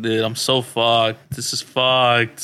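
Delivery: slow retold self-pity; drawn-out dismay. Telling friends about the walk, slow mock dismay. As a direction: stretch it out, quoting yourself in trouble.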